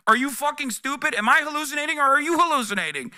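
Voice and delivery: high, fast exasperated rant at chat. Real, not acted. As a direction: fire it back at them, fed up. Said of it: "ranting back at chat".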